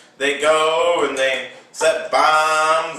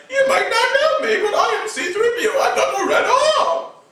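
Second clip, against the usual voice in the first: very high, fast, laughing shout; high giggly robot voice; silly C3PO; faster than usual. Retold or imitated. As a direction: prattle like a fussy robot.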